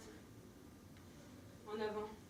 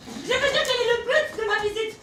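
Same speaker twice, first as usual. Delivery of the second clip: flustered; 9 semitones higher, 15 dB louder than usual. Acted. Flustered correction of a slip.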